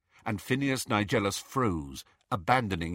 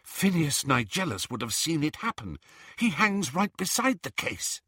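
breathless, pitch climbing up; panting; 6 semitones higher than usual. Acted. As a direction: puff out the words. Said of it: "she panted".

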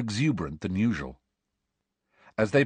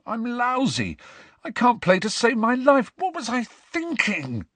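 croaking; 14 semitones higher than usual. A narrator's reading. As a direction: croak it out high and miserable. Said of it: "croaked Ron".

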